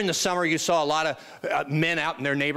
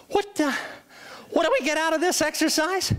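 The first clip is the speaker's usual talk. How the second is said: high, strained mock-agony voice; hammed-up high-pitched exasperation; pained protest; 9 semitones higher than usual. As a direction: squeak it out as if mid-workout. Exhausted trainee questions his trainer.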